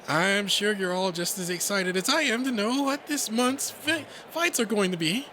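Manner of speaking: high, nervous rabbit voice; jittery, high-pitched public address; timid announcer. Acted. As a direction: get through the speech with shy nerves. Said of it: "The timid little thing".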